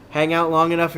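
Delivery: ordinary delivery, nothing marked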